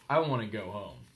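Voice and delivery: low, small sulky voice; sad. A narrator's reading. Nancy after spilling dessert, low and glum. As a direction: say it like a crushed little girl.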